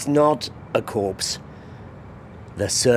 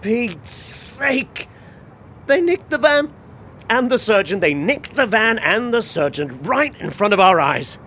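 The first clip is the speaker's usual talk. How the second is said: outraged shout; 8 semitones higher than usual. Real, not acted. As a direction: yell in disbelief at the theft. Van stolen, outraged.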